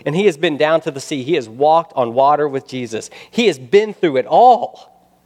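forceful, incredulous preacher's build; impassioned preaching. Real, not acted. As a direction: pile up the evidence with mounting fervor. Preaches at full force, piling up examples.

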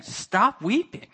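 "Stop weeping" said slowly, high and loud, rising in disbelief; incredulous quote. Retold or imitated. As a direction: question the words "Stop weeping" as if they can't be right. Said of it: "what's this business of some saying".

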